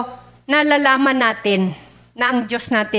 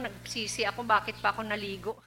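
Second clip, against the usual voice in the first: shivering; 3 semitones lower, 14 dB quieter, faster than usual. Real, not acted. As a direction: voice shaking from the cold. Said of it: "Giniginaw ako".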